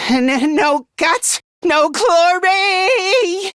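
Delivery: high, long-drawn battle cry; rallying war cry. Acted. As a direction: stretch "glory" into a soaring yell. Motto repeated as a war cry.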